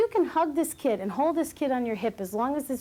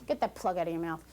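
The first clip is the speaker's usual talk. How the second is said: softer, low mock warning to a child; lightly voiced parent threatening mustard; a hint of a stern parent; 5 semitones lower, 6 dB quieter than usual. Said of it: "If you start saying to the kid".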